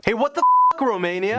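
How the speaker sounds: high, loud, outraged cry; indignant outburst. Acted. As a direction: yell at a friend who ditched you. Neighbor feels ditched.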